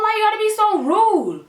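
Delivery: high spoken plea "Why you gotta be so rude?"; high pleading. Retold or imitated. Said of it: "because I'm like".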